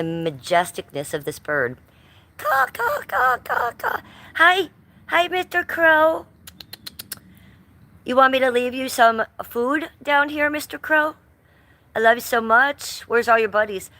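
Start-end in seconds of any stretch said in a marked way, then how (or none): 2.4-4.0 very high cawing crow calls; high crow caws; crow caw imitation. 4.4-13.9 high, louder sweet talk to a bird; sweet high voice for Mister Crow; pet talk to crow. Real, not acted.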